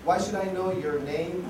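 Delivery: normal talking voice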